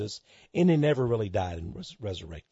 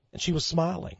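normal talking voice

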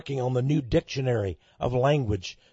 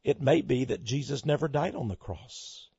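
usual speaking voice

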